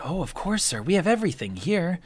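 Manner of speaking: lightly voiced fussing server; eager serving girl fussing over guests.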